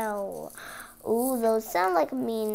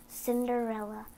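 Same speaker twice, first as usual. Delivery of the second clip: plain reading voice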